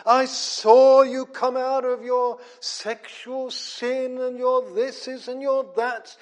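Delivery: Paul groaning. Acted. Paul pleading with the Corinthians to come back. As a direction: plead with lost believers. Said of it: "He's groaning for them".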